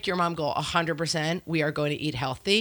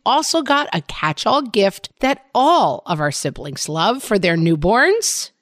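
upbeat sponsor read, loud and bright; 5 semitones higher, 8 dB louder than usual. Acted. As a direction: sell it like a radio ad.

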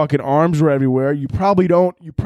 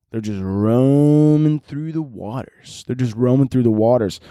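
slow, stretched-out ROAMING; ominous drawn-out delivery; slower than usual. Acted. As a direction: draw it out like a prowling menace. Menacing scene of prowling killer.

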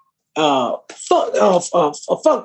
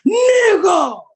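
high, drawn-out holler; 10 semitones higher than usual. Retold or imitated. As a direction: shout a greeting like an overfamiliar fan.

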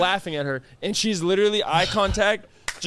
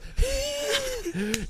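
high breathless wheezing laugh; a squeaky, airless wheeze of laughter; 6 dB quieter than usual. Retold or imitated. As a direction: imitate a friend's wheezy laugh. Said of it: "Her wheeze laugh, she goes".

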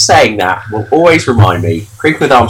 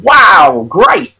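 mock excited; 8 semitones higher, 4 dB louder than usual. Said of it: "I said".

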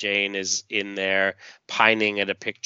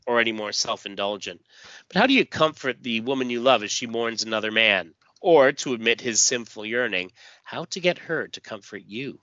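usual speaking voice

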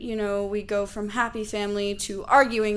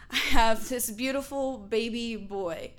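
usual speaking voice

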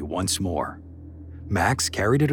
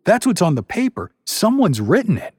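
upset protest; 11 semitones higher, 7 dB louder than usual. A narrator's reading.

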